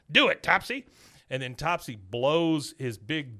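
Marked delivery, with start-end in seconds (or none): 0.1-0.9 mock command. Old man commanding in retold scene.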